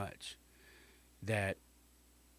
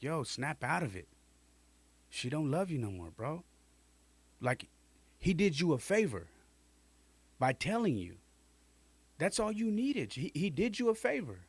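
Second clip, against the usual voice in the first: a hint of the cousin, rising higher as he pushes; lightly voiced cousin; 6 semitones higher than usual. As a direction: push it like a cousin getting fed up. Cousin voiced scolding narrator.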